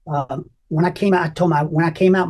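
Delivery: ordinary delivery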